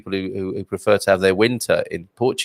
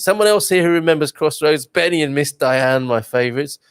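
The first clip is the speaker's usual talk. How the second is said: ordinary talk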